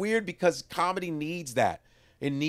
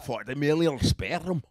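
slower Scottish punchline; Scottish accent; slower than usual. Acted. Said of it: "this Scottish dude was telling me a joke".

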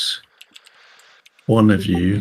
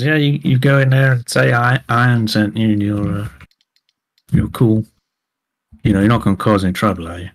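Southern fixer leaning in, half friendly, half warning; a Southern hint of a threat under the charm; Southern club owner sizing up the crew; southern accent. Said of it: "I can't get out the southern accent now".

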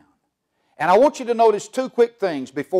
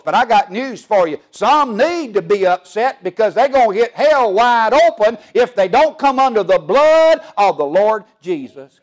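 raised, driving pulpit delivery; full-force preaching; 3 semitones higher than usual. Acted. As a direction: preach it hard and rising to the congregation.

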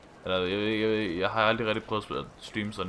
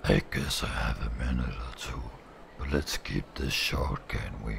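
much lower, gruff-toned tough guy; Kiryu voice; 12 semitones lower than usual. Acted. Streamer giving Kiryu a voice, much lower and gruff. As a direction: drop way down for the stoic hero. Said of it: "jeg har lyst til at give Kiryu en stemme".

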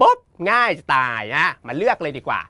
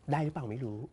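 much softer, nearly hushed; speaking softly; 3 semitones lower, 13 dB quieter than usual. Real, not acted. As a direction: drop the voice right down on purpose. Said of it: "[name]พูดเบาๆ นะคะ".

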